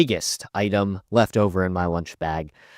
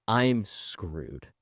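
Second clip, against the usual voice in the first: ordinary talk, nothing marked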